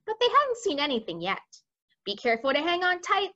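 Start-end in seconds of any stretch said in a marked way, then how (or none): 2.0-3.3 higher, quick giant's warning; lightly voiced giant. A narrator's reading. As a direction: lightly voice an urgent giant character. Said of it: "the B F G said".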